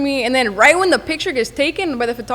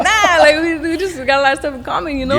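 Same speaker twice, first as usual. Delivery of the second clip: casual friendly reply; 3 semitones higher than usual. Retold or imitated. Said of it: "you're like".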